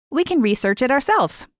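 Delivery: brushing the worry aside, lightly impatient. A narrator's reading. Girl pushing her friend to break rules, mildly impatient. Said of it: "Lee says impatiently".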